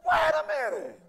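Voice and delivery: high, loud shout of realisation; David shouting. Retold or imitated. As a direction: cry out suddenly, wait a minute. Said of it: "he probably said".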